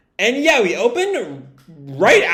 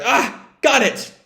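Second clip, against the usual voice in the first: effortful catch; 8 semitones higher, slower than usual. Retold or imitated. Belle grabs the tail.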